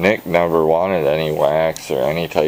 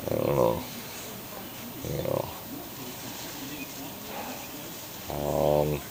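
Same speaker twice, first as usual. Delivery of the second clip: mumbled under the breath; mumbling; 15 dB quieter than usual. Real, not acted. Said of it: "now I'm just mumbling".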